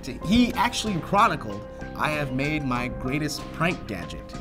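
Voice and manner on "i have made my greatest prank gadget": ordinary talk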